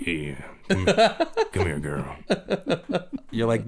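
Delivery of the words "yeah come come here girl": low, rough seductive voice. Retold or imitated. Drill instructor with his wife, a low rough crooner voice. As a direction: growl it like a bad crooner.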